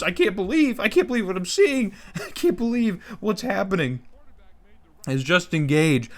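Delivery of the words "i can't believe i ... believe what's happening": high, breathless can't-believe-it excitement; stunned disbelief. Real, not acted. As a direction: gasp it out in disbelief. Stunned by a huge play.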